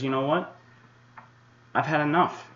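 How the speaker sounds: sudden exasperated outburst, voice rising; fed up. Real, not acted. Forecaster snaps at his Twitter critics, fed up. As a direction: pitch jumps up, "had enough" snapped.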